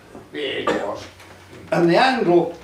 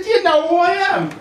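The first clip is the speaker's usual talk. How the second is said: haughty devil; 11 semitones higher, 5 dB louder than usual. Acted. Devil demanding recognition.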